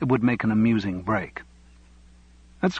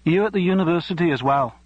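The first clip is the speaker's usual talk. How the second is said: put-on Yorkshire accent; 7 semitones higher than usual. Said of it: "that familiar accent".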